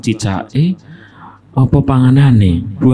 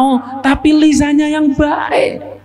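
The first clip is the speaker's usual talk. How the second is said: much higher, slow, near shouting; emphatic; 13 semitones higher, slower than usual. Real, not acted. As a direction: drive the point home. Stresses main point loudly. (penekanan keras dan lambat)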